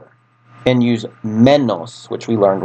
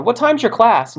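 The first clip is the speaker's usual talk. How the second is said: casual asker; 12 semitones higher than usual. Retold or imitated. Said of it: "like somebody going".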